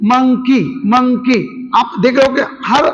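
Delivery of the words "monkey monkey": "monkey" split as mon, then key; syllable by syllable. Shown as a demonstration. Teacher giving students another example, "monkey" split into syllables. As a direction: break "monkey" into two clear beats. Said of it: "शब्द को तोड़ तोड़ के बोला जाता है".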